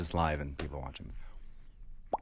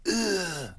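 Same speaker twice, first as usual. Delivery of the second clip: high strangled dying groan; zombie groan; 12 semitones higher than usual. Pretend. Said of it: "That was a zombie sound".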